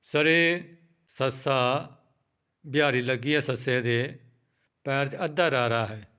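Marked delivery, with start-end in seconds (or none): none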